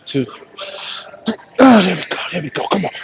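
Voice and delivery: grunting through a heavy lift; straining. Real, not acted. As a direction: push out the words mid-rep.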